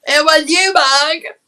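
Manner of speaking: loud, high playful call; excited call-out. Real, not acted. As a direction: call out gleefully to a friend. Calling out to a viewer.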